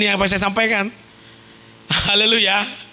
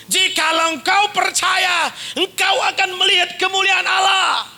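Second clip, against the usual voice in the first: emphatic rebuke; 7 semitones higher than usual. Acted. Jesus answering Martha emphatically.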